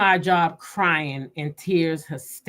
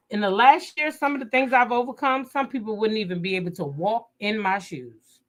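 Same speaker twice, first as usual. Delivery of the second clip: usual speaking voice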